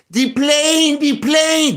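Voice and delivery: high, loud excited cry; mock-excited high-pitched shout; Fantasy Island catchphrase. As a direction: call it out high like the TV sidekick. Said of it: "This is no fantasy island".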